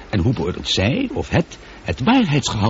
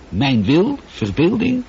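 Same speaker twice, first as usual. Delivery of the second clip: incredulous; 9 semitones higher than usual. Acted. Christopher, stunned by Sophie's theory, incredulous rising questions. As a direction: pitch climbing higher in disbelief.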